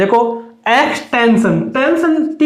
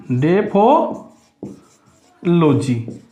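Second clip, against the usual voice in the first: very slow, careful dictation; slow spelled-out term; 4 semitones lower, much slower than usual. Shown as a demonstration. Teacher to class, spelling the new term out very slowly. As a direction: stretch the word out syllable by syllable.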